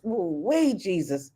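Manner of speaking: ordinary talk, nothing marked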